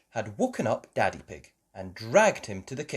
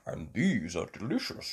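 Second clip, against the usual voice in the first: Daddy Pig voice; 4 semitones lower, 4 dB quieter than usual. A narrator's reading.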